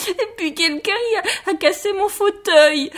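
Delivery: high-pitched, sobbing little bear; crying Baby Bear. A narrator's reading. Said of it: "dit bébé ours en pleurant". (bébé ours en pleurs, voix très aiguë)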